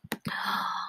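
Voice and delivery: sharp breathless storytime gasp; hammed-up surprised gasp; mock gasp. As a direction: gasp to pull the kids in. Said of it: "Oh my goodness".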